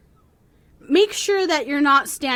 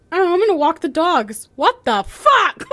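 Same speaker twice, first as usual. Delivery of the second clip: mock confusion; 6 semitones higher, 4 dB louder than usual. Cohost retelling her walking the dogs, mock bafflement. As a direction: start casual then get blindsided. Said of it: "But you're like".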